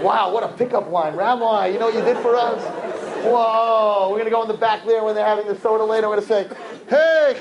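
teasing impression of boys; mock eager guys. Mocking guys using pickup line.

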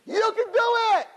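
high, loud crowd yell; crowd screaming. Retold or imitated. Reporters screaming encouragement at the tightrope walker, high loud yell. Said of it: "And they're all screaming".

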